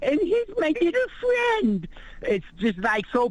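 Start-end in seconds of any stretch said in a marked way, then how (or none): 0.0-1.9 very high, put-on sugary voice; mock patronizing coo. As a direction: coo it like talking to a child. Mocking a patronizing tone.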